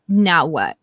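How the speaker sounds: low, disdainful retort; imitated disdain. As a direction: imitate someone put out at being bothered. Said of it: "the most disgust and disdain".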